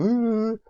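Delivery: high, loud sustained "oooh"; hammed-up panicky wail on one held note; mock panicked "ooh". Vlogger mocking their own possible panicking, high mock-panic "ooh". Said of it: "just kind of panicking and just going".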